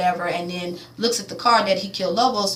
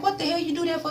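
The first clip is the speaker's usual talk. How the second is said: a lightly voiced, higher protesting dude; mock protest; 9 semitones higher than usual. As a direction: whine the protest in a put-on higher voice. Old dude's protest retold.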